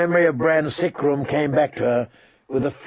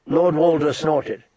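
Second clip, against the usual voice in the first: plain reading voice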